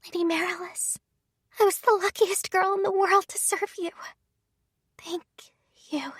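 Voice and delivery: put-on faint, high, breathless whisper of a dying girl; fading gratitude, barely voiced; dying young maid. Dying maid speaks to her lady.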